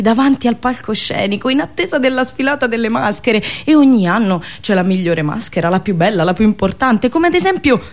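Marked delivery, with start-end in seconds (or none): none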